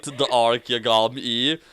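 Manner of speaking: normal talking voice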